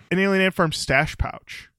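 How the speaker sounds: ordinary delivery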